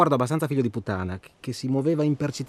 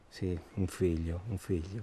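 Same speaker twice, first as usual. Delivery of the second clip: muted musing; 6 semitones lower, 6 dB quieter than usual. Acted. Musing bitterly.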